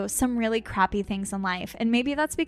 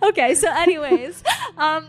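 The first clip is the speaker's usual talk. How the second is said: laughing; 6 semitones higher, 5 dB louder than usual. Real, not acted. Still laughing, moving on.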